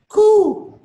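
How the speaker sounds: high dove call; dove coo. Acted. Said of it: "One was a dove".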